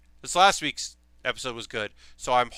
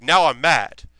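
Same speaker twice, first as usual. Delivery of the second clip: normal speaking voice; 8 dB louder, slower than usual. Retold or imitated. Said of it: "in a normal speaking voice".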